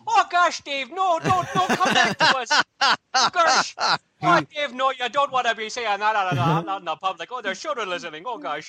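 fretting Brad. Retold or imitated. Brad fretting in the background at Dave's cursing, mock panic. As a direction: squeal like a frantic pearl-clutcher. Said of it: "Brad in the background going".

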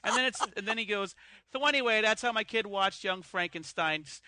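brash New York voice with laughs; New York accent. Pretend. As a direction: talk like a loud New York guy. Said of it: "my accent there".

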